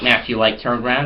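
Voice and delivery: ordinary delivery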